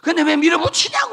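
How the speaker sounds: high, loud protest; loud mock complaint; exasperated shout. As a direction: yell it out in exasperation.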